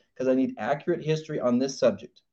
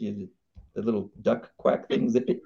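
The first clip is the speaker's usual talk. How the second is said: ordinary delivery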